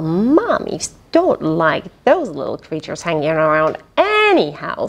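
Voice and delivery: ordinary delivery, nothing marked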